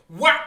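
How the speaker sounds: shouted smack sound effect; loud slap noise. Retold or imitated. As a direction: bark out the hit. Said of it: "And then he goes".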